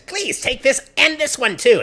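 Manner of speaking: high, loud, drawn-out begging; panicked pleading. Acted. Villager shoves pheasants into Vati's arms, panicked pleading. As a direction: push each word up in a slow, desperate plea. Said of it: "kind of a panic".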